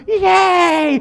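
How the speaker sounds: high, loud cheer of watching friends. Retold or imitated. Friends on the street cheer the rider, excited. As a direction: cheer like a crowd of kids. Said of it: "they were cheering".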